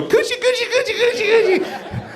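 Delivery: very high squealed Gucci Gucci; [name] impression. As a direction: squeal it like [name] doing her catchphrase. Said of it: "She'd go".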